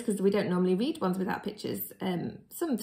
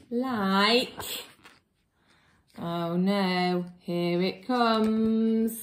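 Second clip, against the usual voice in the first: dread; much slower than usual. Pretend. Reader braces for page.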